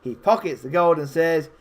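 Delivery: ordinary talk, nothing marked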